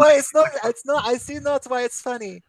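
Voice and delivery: put-on a confused roommate in a Norwegian accent. Roommate confused by the teasing, in a Norwegian accent. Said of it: "thick Norwegian accent".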